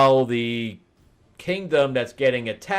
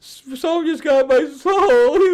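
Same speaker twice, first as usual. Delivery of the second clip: high, loud hammed-up wail; mock crying; 8 dB louder than usual. Demon crying about his lost soul, hammed-up wail. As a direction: whine it out like a blubbering demon. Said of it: "He's all crying".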